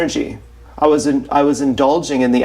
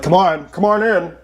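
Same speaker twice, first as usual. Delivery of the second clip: lightly voiced coaxing father, a bit higher; a hint of a father beckoning his child in; calling dad; 4 semitones higher than usual. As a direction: coax him in like a creepy dad calling from his room. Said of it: "he's calling, he's like".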